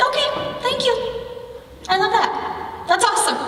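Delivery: high, sing-song mock gratitude; acted chirpy reply, pitched far up; cheerfully unbothered. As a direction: brush off the teasing with breezy thanks. Acts the unbothered reply to bullies.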